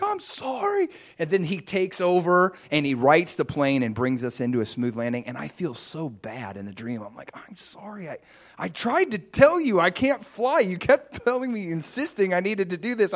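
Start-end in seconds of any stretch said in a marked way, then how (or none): 0.0-0.9 retold squeal of apology, much higher; mock-panicked apology, pitched way up. Dreamer apologises after near crash. 7.3-13.1 high, protesting retold apology; acting out a flustered self-defence; pleading apology. Dreamer pleads with the pilot.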